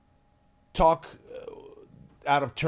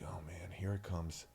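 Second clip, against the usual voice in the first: much lower and softer, bracing; dreading it; 7 semitones lower, 15 dB quieter than usual. Retold or imitated. Kid bracing for the scolding, soft low dread. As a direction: mutter it under your breath. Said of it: "And I was like".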